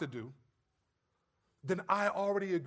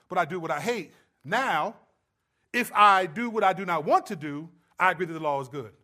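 scripture reading; 6 dB louder than usual. Real, not acted. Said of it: "let's start with verse fourteen".

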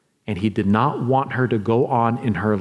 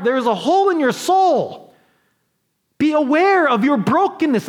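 preaching at full force; 13 semitones higher than usual. Acted. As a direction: drive the call home to the whole church. Preaching climax to congregation.

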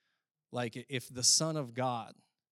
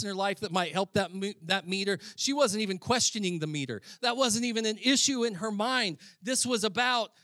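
higher, louder pulpit delivery; impassioned preaching; 8 semitones higher, 7 dB louder than usual. Real, not acted. Preacher insisting to congregation it was never an issue, impassioned. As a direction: preach it with rising urgency.

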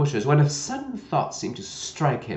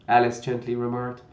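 plain reading voice

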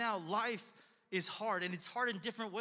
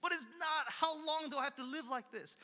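higher-pitched, exasperated appeal; frustration; 4 semitones higher than usual. Acted. Preacher voices David questioning God, frustrated. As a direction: protest to God in mock exasperation. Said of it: "like, frustration".

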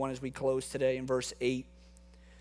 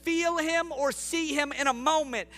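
preaching at full force; 4 semitones higher than usual. Real, not acted. Pastor assuring the congregation God never leaves, impassioned preaching.

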